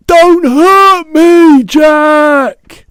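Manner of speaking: high, loud, drawn-out plea; pleading yell. A narrator's reading. Giant begs dream Jack to spare him, desperate yell. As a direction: yell for mercy. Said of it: "he yelled".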